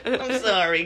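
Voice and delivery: embarrassed patron mock-sniffling a sorry. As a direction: whimper the apology through a laugh. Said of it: "It's a sniffling voice".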